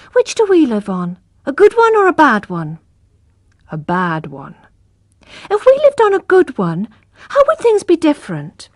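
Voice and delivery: a little brother teasing his sister; young boy. Acted. Little brother Abraham teasing Tess, light child voice.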